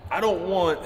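normal talking voice

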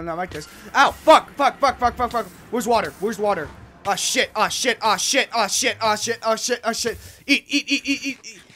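loud, high frantic yelling; real frantic panic shouts; panicked screaming. Real, not acted. Panic dying in lava.

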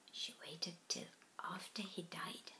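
hurt, hushed whisper. A narrator's reading. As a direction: let it out on a breath. Hurt realization.

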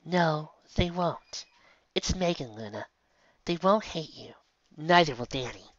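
slow soft reassurance; reassuring, slow. Acted. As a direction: comfort her slowly and softly. Comforting crying sister.

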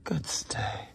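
breathy whispered good stay; whispered praise. Real, not acted. Trainer whispers praise to the resting dog. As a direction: hush the praise so he keeps still.

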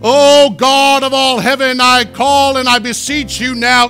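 slow, loud, archaic mock-solemn prayer; hammed-up King James prayer. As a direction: ham up old-English praying. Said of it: "you don't have to turn to King James".

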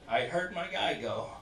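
usual speaking voice

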